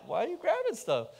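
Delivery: high, loud, baffled why-are-you-grabbing; mock exasperated question. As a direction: play up the innocent driver's disbelief. Retold asking passengers while driving.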